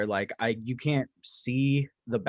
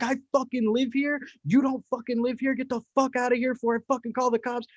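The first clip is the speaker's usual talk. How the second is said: high, fast furious rant; mock rage; 11 semitones higher than usual. Telling off trespassers at the door, raised mock rant. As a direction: let it all out at them. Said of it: "I unloaded rage".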